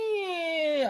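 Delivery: thin, high, drawn-out squeak; mock high whine. Rival down in the first-floor apartment, high mock whine. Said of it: "and you're like".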